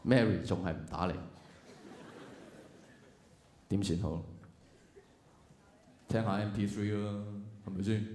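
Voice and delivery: low, soft, deflated voice; mock dejection. Waiting for Mary who still won't call, mock dejection. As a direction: sink into helpless, bored waiting.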